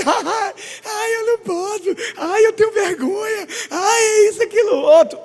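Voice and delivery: high mock-sobbing whimper; crybaby whine. Pastor mimics crying believer, high mock sob. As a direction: ham up a tearful moan. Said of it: "Eu não choro".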